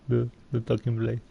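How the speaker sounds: ordinary delivery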